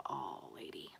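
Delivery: breathy whisper to the bunny. Real, not acted. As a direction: whisper it lovingly. Whispering to her rabbit.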